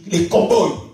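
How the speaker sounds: ordinary delivery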